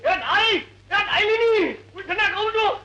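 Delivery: high, insistent wake-up call; loud calling out. Acted. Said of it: "અરે પણ ધીમે બોલો".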